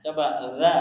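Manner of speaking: ordinary talk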